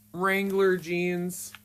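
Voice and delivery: ordinary delivery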